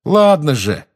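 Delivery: stung and defiant; hurt, offended. A narrator's reading. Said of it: "в голосе Джеймса зазвенела обида".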